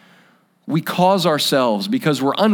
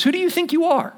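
indignant challenge; 14 semitones higher than usual. Retold or imitated. Religious leaders challenging John.

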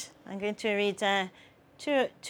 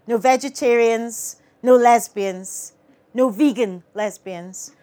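louder, punchy list with pauses between items; satirical poem recital; 7 dB louder, slower than usual. Acted. Poet performs Planet Farage poem to audience, punchy declaiming. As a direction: declaim each item like a slogan.

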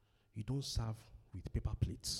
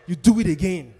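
loud higher shout; 6 semitones higher, 20 dB louder than usual. Real, not acted. Preacher shouts advice to the congregation, loud. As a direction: shout the command to repeat it.

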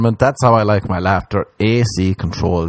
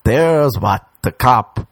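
usual speaking voice

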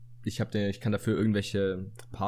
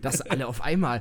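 ordinary talk, nothing marked

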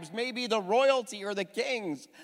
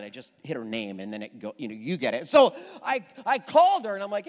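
normal talking voice

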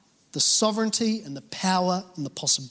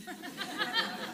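laugh; 13 semitones higher, 12 dB quieter than usual. Real, not acted. Laughs after singing.

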